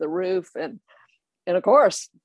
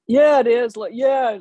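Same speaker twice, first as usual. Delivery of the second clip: insistent; 6 semitones higher, 6 dB louder than usual. Retold or imitated. Friends insisting, retold.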